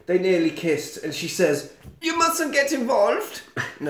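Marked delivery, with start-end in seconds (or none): 2.0-3.4 high, slow, badly faked French accent; squeaky attempt at a Frenchwoman's accent. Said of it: "I can't do a French accent".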